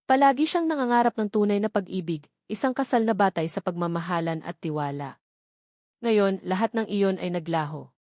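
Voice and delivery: usual speaking voice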